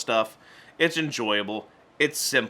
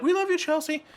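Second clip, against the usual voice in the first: much higher, gushing fan squeal; excited adoring crowd. Retold or imitated. Classmates fawning over popular Chelsea, high gushing squeal. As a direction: swoon over the new girl.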